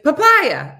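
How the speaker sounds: sudden loud, high yell. Shown as a demonstration. Showing listeners what to do around Chris, yell. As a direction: yell the word out as a demonstration. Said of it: "just yell "papaya".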